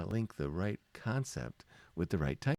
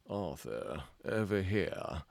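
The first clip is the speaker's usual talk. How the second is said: posh British voice; 2 semitones lower, slower than usual. Acted. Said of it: "what kind of voice do you hear".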